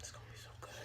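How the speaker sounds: soft eager whisper. Real, not acted. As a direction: whisper it, savoring the thought. Whispered anticipation of tacos.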